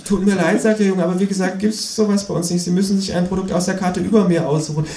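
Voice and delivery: a hint of a bored fast-food boy; cashier boy.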